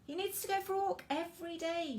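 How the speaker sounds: mum's voice. Acted. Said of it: "she said".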